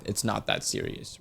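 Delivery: soft unvoiced whisper; whispered aside. Real, not acted. Reviewer whispers to readers it's not that serious. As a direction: drop to a hush, let it go.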